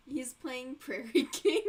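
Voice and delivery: real laughter breaking through slowly; laughing. Real, not acted. Laughing at the game on screen.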